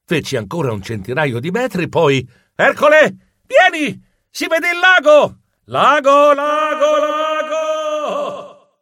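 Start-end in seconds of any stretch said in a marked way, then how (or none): 2.6-5.4 high, loud call across a distance; excited shout. Acted. Geronimo calling Ercole to see the lake, excited shout. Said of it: "Il mio grido". 5.7-8.7 high, ringing echo that fades away; echo of a shout. Acted. Shout bouncing back over the lake, fading echo. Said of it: "non avevo considerato l'eco".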